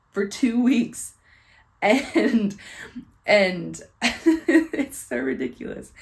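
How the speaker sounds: laughing. Real, not acted. Laughing at memory.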